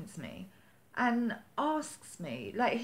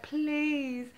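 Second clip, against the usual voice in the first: imploring; 6 semitones higher than usual. Retold or imitated. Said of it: "I was imploring this guy".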